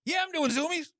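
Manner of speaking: very high dubbed animal voice; high-pitched playful animal imitation; innocent pet dub. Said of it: "it's always super innocent".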